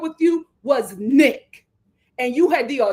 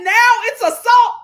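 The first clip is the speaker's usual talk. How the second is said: mocking shout; 10 semitones higher, 8 dB louder than usual. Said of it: "I said".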